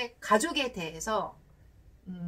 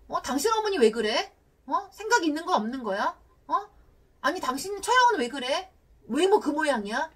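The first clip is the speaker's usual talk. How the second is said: raised, scornful questioning as a bad example; contemptuous criticism; 4 semitones higher, 4 dB louder than usual. Shown as a demonstration. Spouse attacking partner's mother and in-laws, contemptuous criticism. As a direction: demonstrate disparaging someone's in-laws, pitched up. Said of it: "경멸하는 듯한 언어는 쓰지 않는 것입니다".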